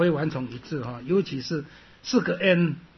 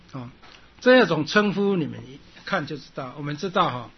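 ordinary talk, nothing marked

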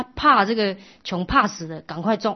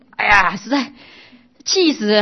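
angry scolding wife; 3 semitones higher, 9 dB louder than usual. Acted. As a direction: scold him for giving away the gold. Wife scolds husband giving away savings.

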